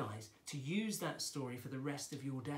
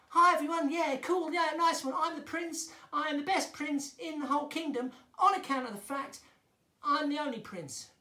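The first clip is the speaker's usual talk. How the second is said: high, loud, cocky prince voice; cool young prince; 9 semitones higher, 6 dB louder than usual. Shown as a demonstration. Prince greeting everyone as the best in the kingdom, cocky young voice. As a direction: show off as a young, ego-driven prince. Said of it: "he's quite young and quite cool".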